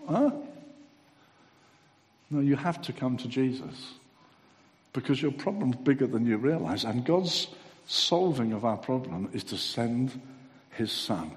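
usual speaking voice

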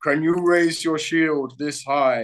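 slow, lordly king voice; Spartan king. Retold or imitated. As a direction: weigh each word like a stern king. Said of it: "the king of the Spartans is like".

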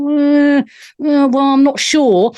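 imitating a hesitant, noncommittal man; drawn-out "uh", mock reluctance to commit; hedging. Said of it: "was definitely hedging his bets, going".

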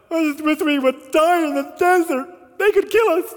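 high, loud fearful whine; loud mock panic; panicked complaint. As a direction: cry it out like a scared crowd. Crowd voiced complaining in fear.

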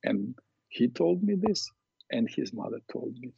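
usual speaking voice

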